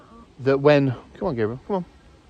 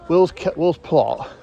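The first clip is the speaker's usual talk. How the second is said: ordinary talk, nothing marked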